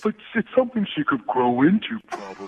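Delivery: goofy cartoon-starfish voice; put-on cartoon dimwit; Patrick. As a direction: play it as a dopey cartoon character. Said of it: "Stop talking like that".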